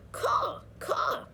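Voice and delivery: high screeching crow caws; crow cawing. Acted. Pellegrina crow cawing at Edward, high screeching. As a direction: shriek out the caws like a bird. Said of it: "said the Pellegrina crow".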